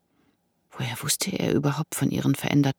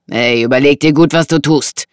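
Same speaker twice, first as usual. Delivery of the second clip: loud, menacing man; threatening warning; 8 dB louder than usual. Acted. Intruder warns woman to think carefully, threatening. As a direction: warn her off forcefully.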